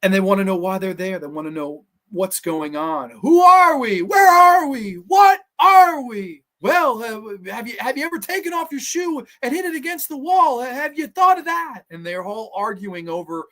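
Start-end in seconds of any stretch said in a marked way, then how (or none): 3.2-6.4 high, loud cries of bewilderment; frantic shouting. Acted. Trapped characters demanding answers from each other, frantic high shouting. 6.6-11.8 high-pitched, put-on retort; arguing character voice. Trapped character arguing with the others over escape ideas, high-pitched retort.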